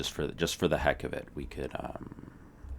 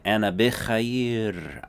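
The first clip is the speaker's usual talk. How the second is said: slow demo; 5 semitones higher, much slower than usual. Shown as a demonstration. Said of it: "And of course you say".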